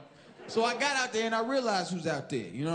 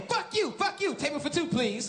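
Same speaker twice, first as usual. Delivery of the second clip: high hissed-sounding couple spat, much higher; mock couple fight; 6 semitones higher than usual. Partner spitting insults at dinner, mock angry. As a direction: snap it out like a bickering partner.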